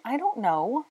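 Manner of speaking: high, desperate, through clenched teeth. A narrator's reading. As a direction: force it out, getting desperate. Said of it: "through clenched teeth, getting desperate".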